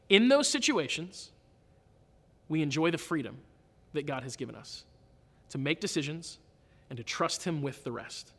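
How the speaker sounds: usual speaking voice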